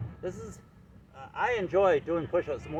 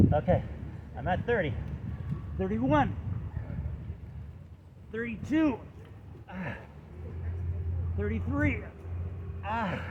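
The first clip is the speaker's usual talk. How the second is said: doing push ups; 4 semitones higher, 6 dB quieter than usual. Real, not acted. Counting reps during push-ups.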